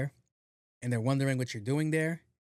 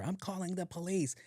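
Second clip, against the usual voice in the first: put-on old lady threat; 6 semitones higher, 5 dB quieter than usual.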